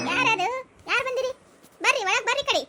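loud, high shout to an unseen caller; calling back. Acted. Householder asking who has come and inviting them in, calling back. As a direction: holler from inside the house.

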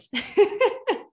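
short high burst of laughter; bright real laugh. Real, not acted. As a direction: laugh at the absurdity. Laughs at own turn.

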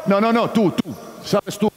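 usual speaking voice

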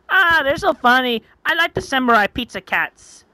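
high-pitched cartoon ninja; boastful Punchy. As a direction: proudly introduce yourself in a high, energetic voice.

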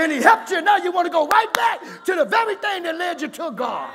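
high, loud, driving sermon voice; full-force preaching. Real, not acted. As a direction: drive it at the congregation in rising waves.

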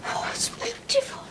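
breathless hushed whisper of wonder; awed whisper. Acted. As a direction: whisper it, slow and amazed. Child awed by gift.

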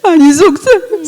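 high, loud, tearful answer; crying. Real, not acted. Daughter answers father, high and crying. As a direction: admit the hurt through tears.

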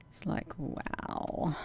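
slow, hushed whisper of disbelief; awed whisper. Real, not acted. Taking in the grim video, slow hushed whisper. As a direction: let the wow fall away to a whisper. Reacting to disturbing footage.

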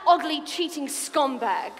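ordinary delivery

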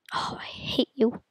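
hissed whisper. Real, not acted. Gamer whispering at an annoying obstacle. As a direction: breathe it out at the screen.

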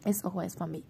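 ordinary delivery, nothing marked

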